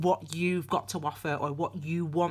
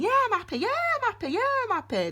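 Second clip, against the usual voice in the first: mock cheerful; faster than usual. Mimicking a self-assured client.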